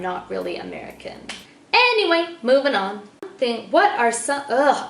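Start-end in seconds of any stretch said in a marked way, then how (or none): 1.7-3.1 brisk brush-off. Acted. Brushing off bad memory.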